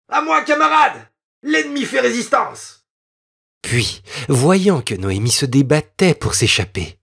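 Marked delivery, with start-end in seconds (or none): 0.1-2.8 much higher mock-battle call; rally a comrade in jest; soldier calling out. A narrator's reading. Soldier playfully calling a comrade while holding the girl, high.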